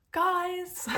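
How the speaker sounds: high, loud cry to the viewers; excited call. Real, not acted. Reader calling out to her viewers, thrilled. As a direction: call them over, thrilled.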